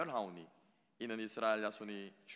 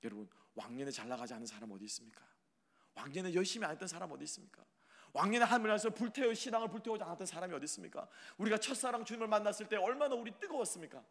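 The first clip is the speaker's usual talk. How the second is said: ordinary talk, nothing marked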